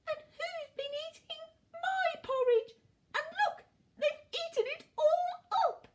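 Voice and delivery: very high squeaky little bear, upset; tiny Baby Bear. A narrator's reading. Baby Bear finds his bowl empty, tiny upset squeak. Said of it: "said in a tiny voice".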